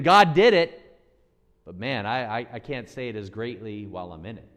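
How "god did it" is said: loud, high proclamation; shouted testimony. Real, not acted. Preacher proclaiming to the congregation, loud triumphant shout. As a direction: ring it out as triumphant witness. Testifying God's deeds at full force.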